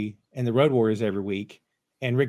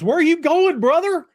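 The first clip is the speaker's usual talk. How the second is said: high, loud mock holler; imitated yell; 11 semitones higher, 4 dB louder than usual. George on the mat to Matt Hardy, imitated yell. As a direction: holler it out, voice pitched way up. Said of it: "Like, he's yelling".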